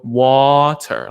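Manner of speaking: "Water" with "Wa" held long, then "ter"; split into syllables. Shown as a demonstration. Telling a child how to go, two-syllable drawn-out "Water". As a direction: draw "Water" out in two clear beats. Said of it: "to signify that are two syllables".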